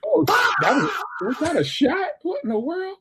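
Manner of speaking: high-pitched playful outcry; exaggerated, high "what was that" disbelief; mock shock. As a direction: act startled and baffled, voice way up.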